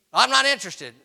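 loud, high flat refusal; firm refusal. Retold or imitated. To the shrimp salesman at the door, a loud firm no. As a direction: shut the salesman down hard.

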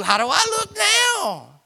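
put-on very high girlish voice; Barbie asking. Wife asking husband about her looks, high and coy.

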